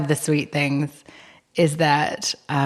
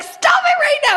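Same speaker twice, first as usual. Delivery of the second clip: very high delighted squeal; 5 dB louder than usual. Retold or imitated. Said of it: "And I was like".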